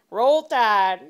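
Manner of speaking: loud Alabama drawl; hammed-up Alabama cheer, loud; mock backwoods Alabama. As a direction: holler it like a backwoods Alabama fan. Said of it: "You look so backwoods Alabama".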